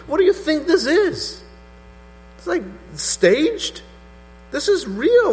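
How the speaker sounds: high, incredulous voice of the grieving widow, voice shooting up; outraged widow. Retold or imitated. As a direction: play the widow demanding how he dares, words climbing. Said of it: "this lady may have been thinking".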